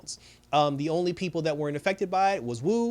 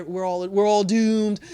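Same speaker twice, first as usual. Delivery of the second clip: mock despair; 4 semitones higher, 6 dB louder, slower than usual. Agnes panicking to Vision.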